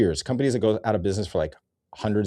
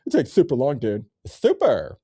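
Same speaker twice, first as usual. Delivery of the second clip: mock super; 5 semitones higher, 5 dB louder than usual. Re-mocking the phrase.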